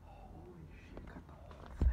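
hushed stunned whisper. Real, not acted. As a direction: breathe it out in awe. Whispered repeat of reaction.

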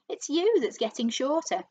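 horribly gleeful. A narrator's reading. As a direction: gloat over the victim. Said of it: "he said, grinning horribly".

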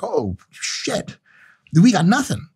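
high, dismayed Chinese officials; lightly voiced alarmed Chinese leaders; mock China. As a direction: play a rattled rival caught out. Imagined China reacting.

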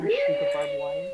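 squeaky voice. Acted. Squeaky high voice.

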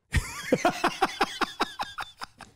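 high, breathless cackle; wheezing laugh. Real, not acted. Laughing at the end of own club story, high wheezing laugh. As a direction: crack up in a long high wheeze.

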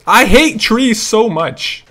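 loud, slow, stretched complaint; exasperated outburst. Real, not acted. Player blocked by trees, loud exasperation. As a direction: belt it out slowly in frustration.

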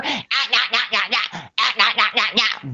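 imitating someone who only says nah; mocking babble. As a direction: parody their one-word yapping. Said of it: "don't even know if you even have a fucking vocabulary".

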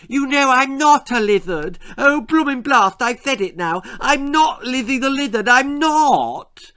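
put-on very high, loud childish wail; upset lisping girl. Little sister protesting her nickname, lisped and wailed. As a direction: lisp it in a tearful tantrum. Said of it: "she started crying".

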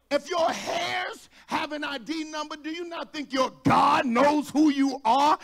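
driving, thunderous sermon climax; full-force preaching. Real, not acted. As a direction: preach it at full volume to a roused congregation.

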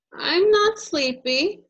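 put-on high, loud toddler insisting; child protesting. Toddler telling mom, high loud protest. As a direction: whine like a kid fighting sleep. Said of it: "you tell me".

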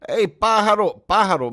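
high, loud call for attention. Real, not acted. Calling out to Pájaro in the chat to get his attention, loud and high. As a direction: shout across the room to grab his attention.

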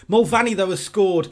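loud, high commentator shout; excited goal call. Real, not acted. Gamer commentating his own team's goal, excited. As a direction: burst out with the goal.

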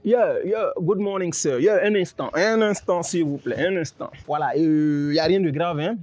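put-on broken French with an American accent; fake American accent in French. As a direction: play a fake American diplomat. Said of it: "Je suis américain".

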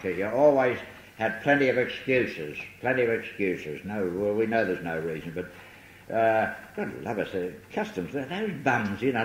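ordinary talk, nothing marked

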